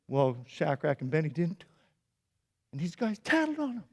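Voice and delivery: usual speaking voice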